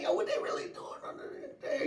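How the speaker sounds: put-on whispered high kid's question; curious kid. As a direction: hush it like a nosy kid. Kids asking about TV scene.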